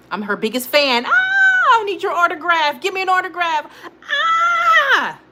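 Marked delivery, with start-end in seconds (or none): 1.1-3.7 high squealing fangirl, ending in a shriek; starstruck fan. Pretend. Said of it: "that's how I am with Sincerely Skylar". 4.0-5.2 high excited squeal; playful shriek of delight; fangirl shriek. Pretend. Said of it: "that's how I am".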